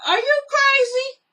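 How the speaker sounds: high, shrieking are you crazy; outraged disbelief. Retold or imitated. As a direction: squeal it in shocked outrage. Outraged at husband cussing.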